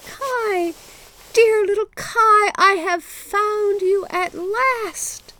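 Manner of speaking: high, loud joyful cry. A narrator's reading. Gerda embraces long-lost Kai at last, crying out high.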